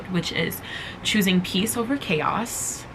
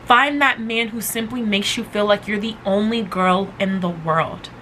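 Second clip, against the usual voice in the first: usual speaking voice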